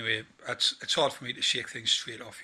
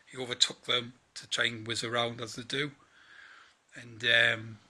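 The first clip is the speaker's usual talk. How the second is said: normal talking voice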